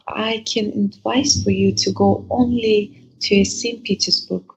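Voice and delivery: ordinary talk, nothing marked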